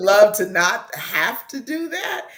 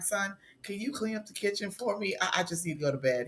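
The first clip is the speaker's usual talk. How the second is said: tired quiet request; 2 semitones lower, 11 dB quieter, much faster than usual. Retold or imitated. Tired mom asking son.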